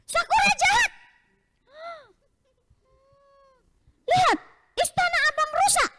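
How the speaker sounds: very high, tearful shouting big brother; angry child. Acted. Boy shouts at his sister over the broken castle, high tearful. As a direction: wail the accusation at her.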